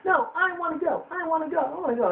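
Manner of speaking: put-on high squeaky whining child; whiny girl. Girl begging to go along, high whiny voice. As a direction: whine it high and fast. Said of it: "She kept whining and bitching about it".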